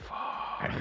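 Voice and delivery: soft breathy whispered swear; whispered curse. Retold or imitated. Boyfriend parked beside police cars, whispered curse. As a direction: breathe it out under your breath. Parking by cops, kids crying.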